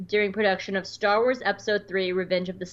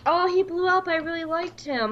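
higher-pitched mock disappointment; whiny fan imitation; 8 semitones higher than usual. Host imitates friend lamenting a favorite villain, mock disappointed.

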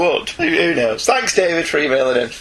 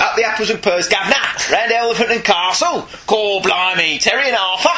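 hammed-up cockney, pitched higher; cockney bit; 3 semitones higher than usual. Mocking a listener's British email, put-on cockney. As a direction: do a Del Boy style cockney patter. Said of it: "doing Only Fools and Horses".

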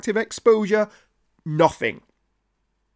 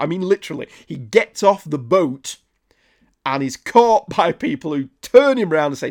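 ordinary talk, nothing marked

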